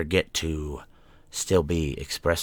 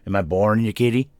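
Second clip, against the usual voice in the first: higher, louder teasing question; playful pet talk; 5 semitones higher, 8 dB louder, faster than usual. Real, not acted. Podcaster teasing the yawning cat, playful pet talk. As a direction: tease the cat, pitched up and louder.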